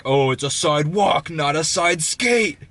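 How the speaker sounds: mock angry retort. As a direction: hammer it out as the indignant man. Angry man's line, acted out.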